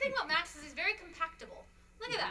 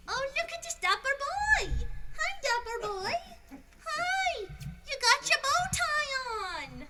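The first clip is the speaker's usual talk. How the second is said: sweet-talking the dog in a much higher voice; 9 semitones higher than usual. Real, not acted.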